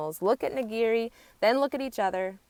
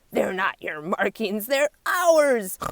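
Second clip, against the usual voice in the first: snorting retort; 3 semitones higher, 7 dB louder, slower than usual. A narrator's reading. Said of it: "snort the warthogs".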